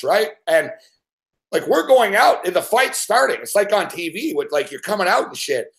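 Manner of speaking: normal talking voice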